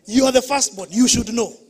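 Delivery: loud, scolding parent's voice; mock parental scolding. Parent scolding the firstborn child, loud and accusing. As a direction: throw the blame like a strict parent.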